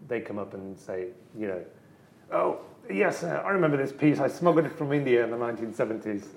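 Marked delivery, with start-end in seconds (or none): 2.3-6.3 higher, posh-ish mock collector voice lightly done; eccentric old collector. Visitor recalls smuggled piece, mock eccentric collector. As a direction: imitate the old collector bragging gently.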